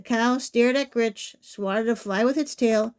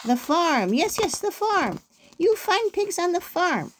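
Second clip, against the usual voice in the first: high, excited exclamations; gleeful, swooping up high; triumphant realisation; 7 semitones higher than usual. A narrator's reading. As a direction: celebrate finally getting the answer. Witch finally gets it.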